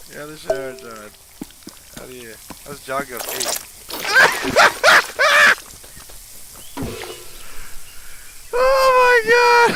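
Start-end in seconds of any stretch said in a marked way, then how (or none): 3.9-8.5 long, high cackling fit; helpless real laughter. Real, not acted. Player laughing at how John goes pee, helpless cackle. Cracking up at the game. 8.5-9.7 long, high, loud wail; hysterical shout. Real, not acted. Player overcome by the silly game, hysterical drawn-out shout.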